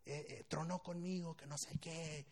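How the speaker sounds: soft, drawn-out mock sobbing; imitated crying. Crying girl in café says boyfriend dumped her, mock tearful. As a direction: lightly imitate someone crying over a breakup. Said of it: "llorando así, mal plan".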